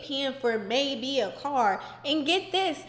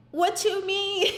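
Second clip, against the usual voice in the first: stunned; 6 semitones higher, slower than usual. Retold or imitated. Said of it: "they were like".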